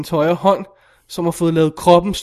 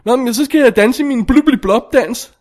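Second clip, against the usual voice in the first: high, loud silly character voice; mock film character; 6 semitones higher, 5 dB louder than usual. Character announcing a nonsense dance, mock silly. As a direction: parody a nonsensical movie line. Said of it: "Det er bare sådan noget".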